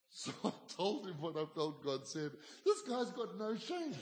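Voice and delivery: chuckling through the words, amused at the memory; laughing while telling. Real, not acted. Telling the congregation about the bold friend, laughing. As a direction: let a real laugh run under it. Recalling embarrassing restaurant moment.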